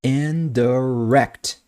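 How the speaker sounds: "indirect" drawn out slowly, final "rect" strong; very slow "indirect", leaning on "rect"; stress on last syllable. Shown as a demonstration. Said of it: "é a minha sílaba forte".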